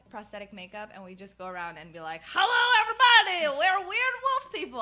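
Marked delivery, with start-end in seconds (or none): 2.2-4.8 high, shouted hammed-up foreign accent; terrible Borat-like accent. Performer greeting the crowd as a werewolf, loud terrible accent. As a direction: bellow it in a cartoonish foreign accent. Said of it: "we had terrible accents".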